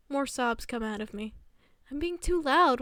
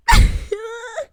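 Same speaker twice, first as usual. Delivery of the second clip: loud laugh; 10 semitones higher, 15 dB louder than usual. Real, not acted. Burst of laughter.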